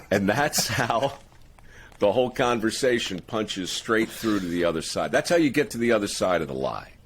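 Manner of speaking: ordinary delivery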